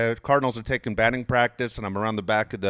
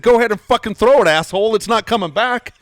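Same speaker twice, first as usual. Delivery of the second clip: much higher and louder retold outburst; snapped, angry yell; 11 semitones higher, 10 dB louder than usual. Snapping back at the catcher who bumped him, angry yell. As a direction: re-enact losing your temper at full voice. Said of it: "I, I snapped".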